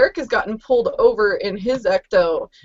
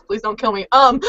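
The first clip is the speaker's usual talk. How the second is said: ordinary talk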